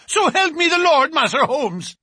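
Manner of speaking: terrified bruiser swearing to God; panicked plea. A narrator's reading. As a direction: beg for mercy in a panic.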